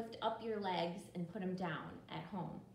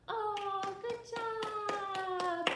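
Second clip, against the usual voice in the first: high, loud cheer; excited praise; 8 semitones higher, 5 dB louder, much slower than usual. Real, not acted. Teacher praising kids after the song, excited cheer. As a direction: cheer them on, drawing it out.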